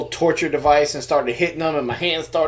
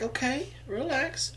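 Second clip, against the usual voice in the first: mock calming; 12 semitones higher, 9 dB quieter than usual. Calming eager son.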